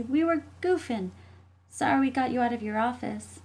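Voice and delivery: put-on high child voice, apologetic; innocent little sister. Jessie covering up the fight to mom, innocent. Child character placates mother.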